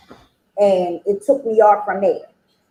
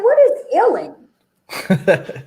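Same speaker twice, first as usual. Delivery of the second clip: slow, laughing disbelief; laughing question; 7 semitones higher, much slower than usual. Retold or imitated. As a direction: laugh through the puzzled question. Confused, laughing at new slang.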